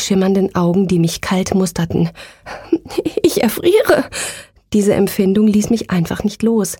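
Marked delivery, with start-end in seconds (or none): 3.2-4.1 high, frightened outcry; panicked cry. Acted. Terrified narrator cries out.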